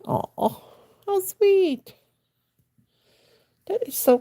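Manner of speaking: squeaky high aww how sweet; touched, high. Real, not acted. Touched by a viewer's kind comment, squeaky high. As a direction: melt at the compliment, voice jumping up.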